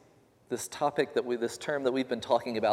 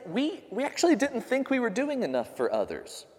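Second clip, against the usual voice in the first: humble believers; 13 semitones higher than usual. Retold or imitated. Humble group quoted, high voice.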